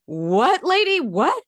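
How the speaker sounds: baffled onlooker, jumping up high; mock incredulity. Speaker silently to the frantic mom, in mock incredulity. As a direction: react in acted disbelief at the mother.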